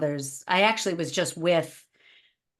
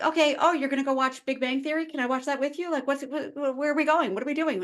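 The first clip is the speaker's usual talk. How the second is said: playfully over-attached mom, pitched up; clingy pestering; 8 semitones higher, faster than usual. Acted. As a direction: tumble out needy questions without stopping. Clingy mom pestering her son.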